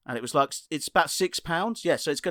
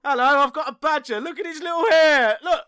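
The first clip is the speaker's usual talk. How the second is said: much higher, louder squeaky nonsense voice; silly squeaky comic; 11 semitones higher, 4 dB louder than usual. Pretend. Host doing a mock badger comic act, high and squeaky. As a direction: ham up a squeaky daft puppet voice.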